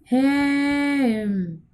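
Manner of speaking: हेम with the vowel stretched very slowly; long calling vowel. Shown as a demonstration. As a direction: call हेम as if to someone far away. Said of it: "किसी व्यक्ति को दूर से बुलाना हो". (हेम को दूर से पुकारते हुए बहुत लंबा खींचकर)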